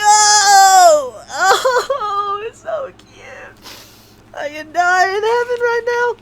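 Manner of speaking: very high squeal thinning to a whispery squeak; overcome with delight. Real, not acted. Fan who could die in heaven right now, delighted squeal. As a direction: squeak as if melting with joy.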